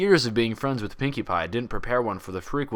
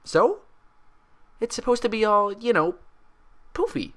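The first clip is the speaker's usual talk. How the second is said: high, bubbly pony voice, puzzled; Pinkie voice; 13 semitones higher, 5 dB louder than usual. Acted. Pinkie asking Twilight about her hair, high and bubbly. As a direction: bounce up high and curious.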